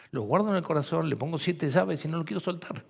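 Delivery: usual speaking voice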